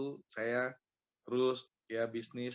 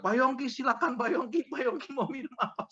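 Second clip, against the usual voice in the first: high-pitched, effusive welcome in imitation; eager-to-please branch manager; 12 semitones higher, 5 dB louder than usual. Branch head welcoming a valued customer, effusively polite. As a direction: fuss over an important guest, voice pitched way up. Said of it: "dia bilang".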